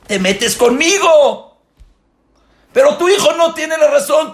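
high, loud fatherly shout; impassioned preaching. Acted. Rabbi voicing a parent defending his son, loud shout.

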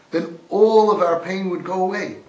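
loud, high and impassioned, leaning on "all"; a fervent shout that hits "all" hard; crying out. Acted. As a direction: preach "then all of our pain would go away" at full force, high and loud. Loud preached take, then quiet repeat.

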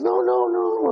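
very high, hasty-sounding cover-up; unconvincing high-pitched protest; strained denial. Acted. Father denying to his son he is upset, strained.

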